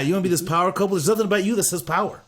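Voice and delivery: "couple" pushed up high in disbelief; incredulous jab. Real, not acted. Repeats rhetorical jab at Nate.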